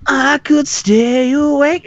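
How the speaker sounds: slow, high, spaced-out voice; dazed rider. Retold or imitated. Rider in the dark tunnel, slow and dazed. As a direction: drift through it as if mesmerized. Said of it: "and it's like".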